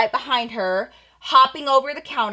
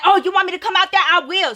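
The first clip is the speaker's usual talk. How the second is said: retold challenge; 6 semitones higher, 3 dB louder, faster than usual. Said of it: "She was like".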